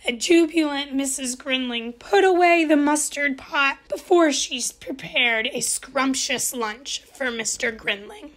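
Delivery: ordinary delivery, nothing marked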